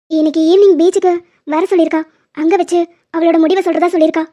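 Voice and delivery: normal talking voice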